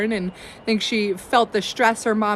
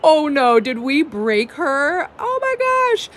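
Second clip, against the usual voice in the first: startled, pitch jumping on oh my; a playful alarmed gasp, retelling the fright; mock panic; 5 semitones higher than usual. Parents fearing they broke the baby, mock panic. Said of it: "And we were like".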